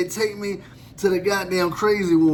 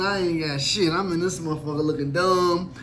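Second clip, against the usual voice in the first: normal talking voice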